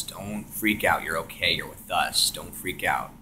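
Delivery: calm him down like a mother; soft motherly voice. Retold or imitated. Presence calming a panicking tripper in hushed motherly tone. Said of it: "like a motherly voice".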